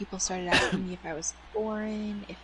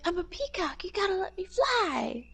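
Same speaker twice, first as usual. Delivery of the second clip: higher movie-quote voice; 10 semitones higher than usual. Retold or imitated. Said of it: "he was like".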